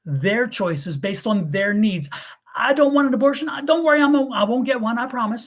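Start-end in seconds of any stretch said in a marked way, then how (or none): 2.6-5.5 mock pleading. Talking about other people's choices, mock pleading, then a high sarcastic retort. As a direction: act out the naive promise, then snap back.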